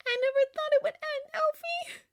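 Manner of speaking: put-on high, tearful young woman; weepy, fading toward a whisper; sniffling. A narrator's reading. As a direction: lament it in a high crying voice. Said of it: "she sniffed".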